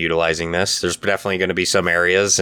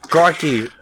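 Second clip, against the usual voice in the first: a quick hammed-up Aussie "Crikey", higher; mock Australian crikey; 8 semitones higher than usual. Podcast host joking about a sexy Australian recipe voice, mock Aussie. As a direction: toss off a playful Australian exclamation.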